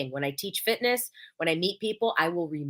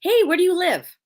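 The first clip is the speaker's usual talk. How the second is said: much higher, louder cheery hey; bright friendly question; 10 semitones higher, 6 dB louder than usual. Shown as a demonstration. Asking a new contact where they live, cheerfully. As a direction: ask it bright and interested. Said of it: "the question I ask 'em".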